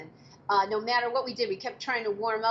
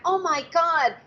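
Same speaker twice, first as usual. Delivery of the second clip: high, loud startled oh my God; retold panic at the wig; shocked gasp; 7 semitones higher, 7 dB louder than usual. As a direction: blurt it out in shock. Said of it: "I went".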